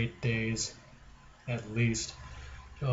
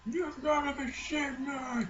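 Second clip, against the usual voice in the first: high, laughing and crying out; 14 semitones higher, 7 dB quieter, slower than usual. Real, not acted. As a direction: yelp of pained laughter. Laughing cry at cat.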